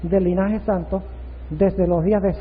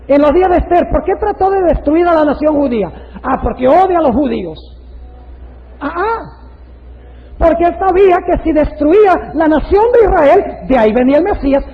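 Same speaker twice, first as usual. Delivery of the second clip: loud, high preaching delivery; forceful preaching; 8 semitones higher, 7 dB louder than usual. Real, not acted. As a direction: drive the argument home at full voice.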